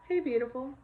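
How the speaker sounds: a quick tender hello to a little one. Real, not acted.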